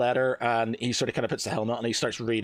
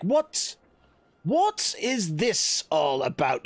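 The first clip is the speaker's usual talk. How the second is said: baffled demand; 7 semitones higher, much slower than usual. Acted. Acted demand for explanation at arrest.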